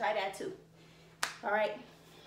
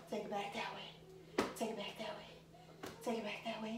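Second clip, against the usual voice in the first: soft, breathy demo count; 10 dB quieter than usual. Shown as a demonstration. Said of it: "demonstrating, winded".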